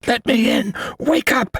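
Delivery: high, panicked urgent call; urgent hiss. A narrator's reading. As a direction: shake them awake in terror. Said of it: "Xavier hissed".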